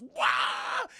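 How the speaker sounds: loud, high mock screech; sudden jump-scare yell; startling creature shriek. Imitates creature hitting the window.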